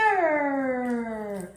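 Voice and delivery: FEATHER stretched long, lingering on the final R; slow, falling Feather with a long R; ending sound shown. Shown as a demonstration. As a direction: draw out Feather and hold its ending. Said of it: "it is the R sound".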